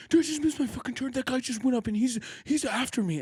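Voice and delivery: retold panic, hammed-up; mock freaking out. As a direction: imitate a frantic guy who missed his turn. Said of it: "literally freaking out".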